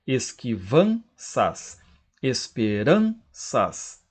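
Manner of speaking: slow syllables, VAN in "Esquivanças" and RAN in "esperanças" stretched; stressed syllable shown. Shown as a demonstration. Teacher marking tonic syllables, slow stress on VAN and RAN in "Esquivanças, esperanças". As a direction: split "Esquivanças, esperanças" slowly, landing hard on VAN and RAN. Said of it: "Qual é a sílaba tônica".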